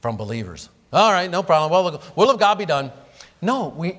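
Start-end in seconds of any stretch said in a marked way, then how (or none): 0.9-2.9 "Okay, whatever, the will of God be done" high and loud, tossed off carelessly; dismissive shrug. Retold or imitated. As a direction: throw up your hands and brush it off. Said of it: "I've heard that at times from believers".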